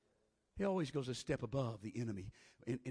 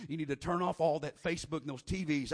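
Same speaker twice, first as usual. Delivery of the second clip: usual speaking voice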